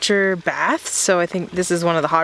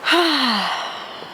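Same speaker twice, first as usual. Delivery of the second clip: contented sigh; 6 semitones higher than usual. Real, not acted. Relaxing in the hammock.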